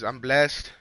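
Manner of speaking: ordinary talk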